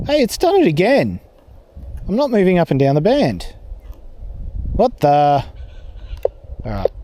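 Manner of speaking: ordinary talk, nothing marked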